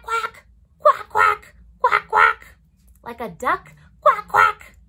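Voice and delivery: high, loud quacking; playful duck imitation; duck quacks. Shown as a demonstration. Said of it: "Can you guys say quack".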